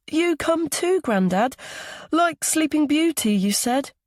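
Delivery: put-on high, childish coaxing; little boy. A narrator's reading. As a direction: plead like a small grandson to grandad. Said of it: "the boy plays with his hair".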